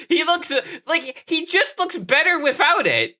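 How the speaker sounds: high-pitched, impassioned protest; worked up, voice pushed way up high; exasperated rant. Real, not acted. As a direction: argue it in a high, insistent voice. Comic rant about a hat.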